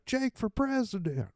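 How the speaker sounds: high cheering crowd voice; mock crowd cheer. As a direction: sell it like fans hyping him.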